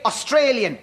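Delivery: ordinary delivery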